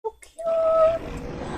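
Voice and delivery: very high squeaky coo. Real, not acted. Player cooing at the tiny ice dragon.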